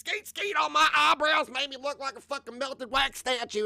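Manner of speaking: high, loud sarcastic tirade; over-the-top high-pitched mock confession; mocking rant. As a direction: shout the absurd excuse in a put-on voice. Absurd mocking tale of the lie's source.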